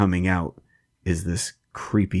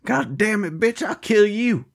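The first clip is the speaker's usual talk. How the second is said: mock hillbilly fury, pitched up; Bunny Man; 14 semitones higher than usual. Acting Bunny Man's threats.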